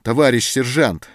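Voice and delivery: loud, high plea of «Товарищ сержант!»; imploring cry. A narrator's reading. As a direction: call out «Товарищ сержант!» begging. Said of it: "взмолился Антон".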